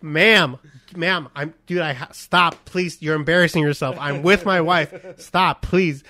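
loud, exasperated pleading to stop; mock firm refusal. Husband telling a woman to stop, loudly dismissive. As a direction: cut her off loudly, feigning embarrassment for her. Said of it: "I was straight up like".

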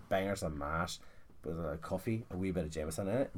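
low, soft narration of a joke; hushed storytelling. Acted. Comic tells of the bride coming downstairs, low and hushed.

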